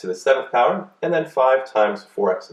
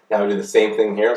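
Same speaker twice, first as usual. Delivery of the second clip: usual speaking voice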